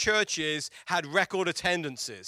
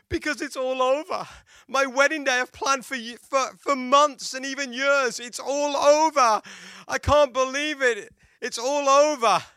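higher, louder put-on wail, slowed; mock crying bride; 7 semitones higher, 6 dB louder than usual. Bride crying to her husband on the wedding night, high mock wail. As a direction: ham up a weeping bride's lament. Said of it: "she keeps crying and crying and crying".

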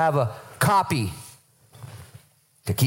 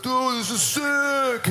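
high, drawn-out dude voice; comic, slow goofy amazement; dopey awestruck Peter; 9 semitones higher, much slower than usual. Acted. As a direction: stretch it out like a thrilled dude. Peter saying stupid stuff, imitated.

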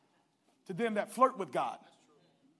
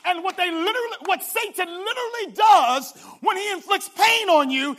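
shouting, building preacher cadence; full-force preaching; 5 semitones higher, 11 dB louder than usual. Acted. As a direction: preach it at the top of your lungs.

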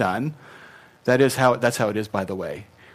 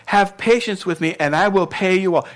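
a higher, lightly acted begging debtor; begging plea; 6 semitones higher, 4 dB louder than usual. Fellow servant on his knees begging for time, mild pleading. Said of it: "fell down at his feet and begged him".

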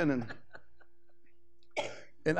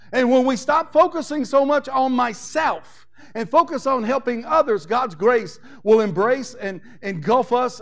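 preaching at full force; 2 semitones higher, 4 dB louder than usual. Acted. Pastor rallying his church to repair the breach, loud fervent preaching. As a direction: build the congregation up with every phrase.

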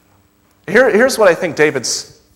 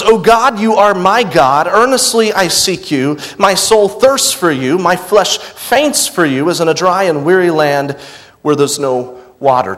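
raised, louder earnest psalm reading; fervent scripture reading; 3 semitones higher, 4 dB louder than usual. Acted. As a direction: read it out like a cry to God. Preacher reads the psalm aloud.

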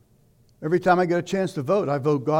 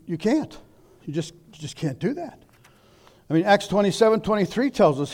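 ordinary talk

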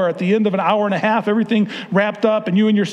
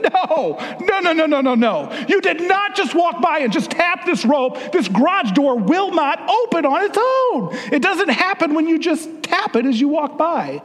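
high, insistent retold scolding of a son; exasperated scolding; 10 semitones higher than usual. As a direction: keep hammering no at the boy, pitched up.

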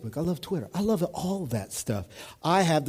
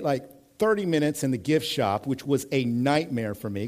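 normal talking voice

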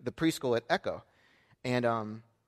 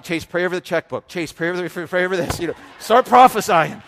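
loud, high, hammed-up urging; mock urgent pleading; 6 semitones higher, 14 dB louder than usual. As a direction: comically command the child, building to a shout. Father jokingly urges son to pray.

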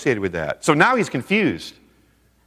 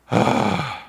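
big audible exhale; imitated sigh of relief. Woman talked off the ledge, acted sigh of relief. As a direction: let out a long relieved sigh.

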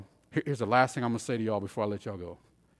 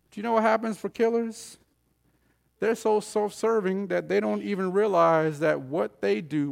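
ordinary talk, nothing marked

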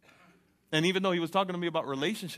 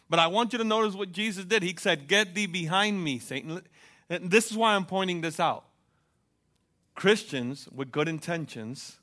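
loud, driving sermon delivery; preaching at full force; 3 semitones higher, 7 dB louder than usual. Acted. Preacher rousing the church, loud and driving. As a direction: preach it out to the congregation.

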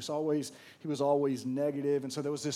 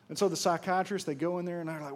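ordinary talk, nothing marked

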